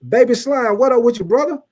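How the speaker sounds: high, loud greeting; excited shout-out. Real, not acted. Host greeting Baby Slime in chat, excited. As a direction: call him out with energy.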